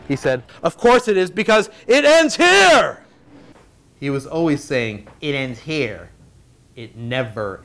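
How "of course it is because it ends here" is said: building to a high, drawn-out shout; hammed-up battle cry, stretching the last words; dramatic declaration. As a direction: declare it like a melodramatic hero. Mick's dramatic declaration, then mocked.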